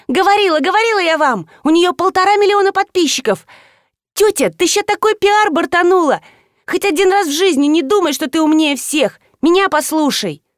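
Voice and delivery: put-on high, insistent girlish outcry; вопль школьницы. A narrator's reading. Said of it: "заявила девица и завопила". (вопит высоким девчачьим голосом)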